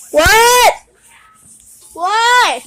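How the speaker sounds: loud high startled yell; shocked shout. Real, not acted. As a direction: blurt out in alarm. Startled outburst.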